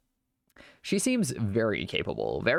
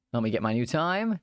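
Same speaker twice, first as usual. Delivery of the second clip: ordinary talk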